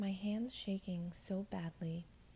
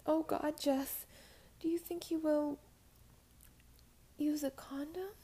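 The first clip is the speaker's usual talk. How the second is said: high, loud, panicked plea; 9 semitones higher, 4 dB louder than usual. Acted. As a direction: terror rising in her voice. Sudden panic.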